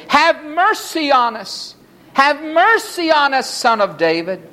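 high, insistent plea; repeated crying out. A narrator's reading. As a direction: keep calling out high for mercy. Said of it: "they said it again and again".

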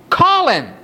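calling out. A narrator's reading. Jesus summons the blind man.

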